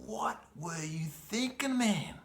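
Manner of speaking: high, slow, alarmed inner voice; high strained voice berating himself; panicked self-scolding. Retold or imitated. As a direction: scold yourself in sudden panic. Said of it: "I was like".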